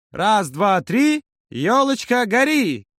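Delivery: high, drawn-out chanting call; festive countdown. A narrator's reading. Юра lights the New Year tree garland, festive calling out. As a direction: call it out slowly like a holiday cheer.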